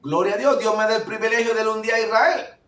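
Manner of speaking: normal talking voice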